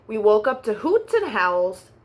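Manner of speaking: ordinary delivery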